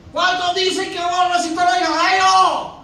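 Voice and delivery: high, loud call to the church; preaching shout. Real, not acted. Preacher asking the congregation for faith, loud shout. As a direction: shout it out for an amen.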